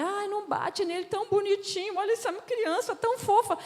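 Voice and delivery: put-on high, cooing plea to spare the child; a mother's high, coaxing "don't hit him". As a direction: coo over the cute little boy, begging.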